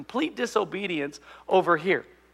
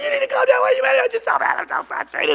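put-on very high, frantic kid squeal; hammed-up childish meltdown; frustrated almost to tears; 5 dB louder than usual. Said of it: "to the point that I almost cry".

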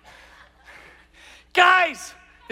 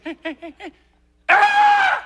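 monkey building to a scream; 7 dB louder than usual. Retold or imitated. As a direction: wind up like an ape about to throw. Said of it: "and he goes".

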